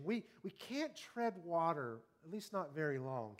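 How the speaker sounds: normal talking voice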